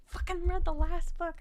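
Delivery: loud, exasperated outburst at herself; frustrated self-quote. Retold or imitated. Scolding herself for skipping the last book, loud and exasperated. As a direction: blurt it out, fed up with yourself. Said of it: "And I'm like".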